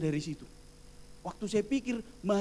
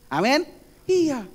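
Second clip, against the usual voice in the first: loud call; 8 semitones higher, 10 dB louder, slower than usual. Real, not acted. Preacher calls to congregation.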